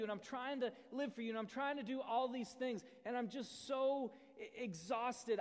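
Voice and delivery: a tantrum at God, building; worn-out believer venting to God; frustrated prayer. Acted.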